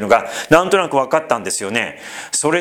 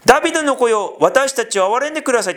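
pitched up, fast pleading call; a blind man calling out to be heard; higher, hurried plea; crying out for mercy; 8 semitones higher, faster than usual. Retold or imitated. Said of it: "って叫んだ時に".

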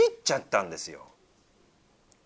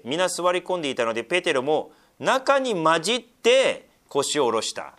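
higher, louder, slow declaiming read; slow scripture reading; 8 semitones higher, 8 dB louder, slower than usual. Acted. Preacher reading Peter's story to the church, slow and raised. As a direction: lean on each phrase of the passage.

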